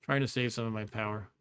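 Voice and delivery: usual speaking voice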